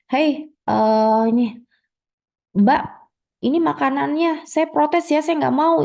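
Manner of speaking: high-pitched indignant complaint; a customer fed up with her dish; angry customer. Acted. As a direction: demand answers from the waiter, pitch up.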